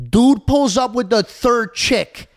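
slow, high, loud drawn-out outrage; shocked disbelief. Retold or imitated. Telling friend dad's date brought a third girl, stunned drawl. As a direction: stretch it out in disbelief.

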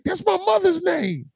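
high, slowed mock outrage; high, slow mock offended; indignant disbelief. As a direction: protest half-joking. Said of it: "I was like".

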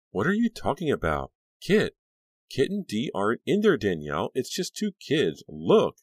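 high, loud urgent protest; urgent insistence. Acted. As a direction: shake her out of it with your voice. Friend snapping her out.

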